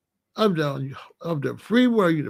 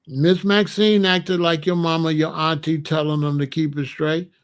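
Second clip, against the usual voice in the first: ordinary talk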